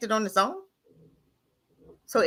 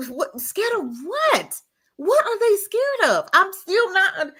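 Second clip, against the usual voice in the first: higher, slow, drawn-out disbelief; incredulous disbelief; 9 semitones higher, slower than usual. Real, not acted. Mocking a political text urging people to come together, slow incredulous disbelief. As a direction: stretch each word in mock bewilderment. Mocking the idea of being scared.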